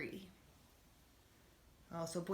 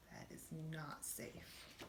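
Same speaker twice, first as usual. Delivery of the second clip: soft slow whisper; whispered aside; 2 semitones lower, 15 dB quieter, slower than usual. Real, not acted. Teacher whispering a warning to the class, slow. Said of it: "teacher aside whispered".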